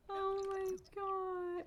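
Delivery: slow, very high coo; melting adoration. Real, not acted. Player adoring her cute puppy, high melting coo. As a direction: draw it out high, utterly smitten.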